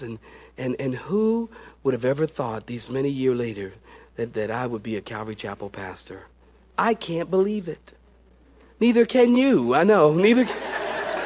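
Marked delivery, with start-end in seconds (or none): none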